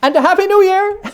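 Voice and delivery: very high, loud holiday wish; beaming greeting. A narrator's reading. Nephew wishing his uncle a happy new year, very high and loud. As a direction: beam it out.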